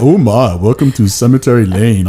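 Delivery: badly put-on cartoon welcome; terrible cartoonish first-attempt voice; Hanna-Barbera character. Shown as a demonstration. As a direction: greet like a hammy cartoon character. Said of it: "a character from Hanna-Barbera".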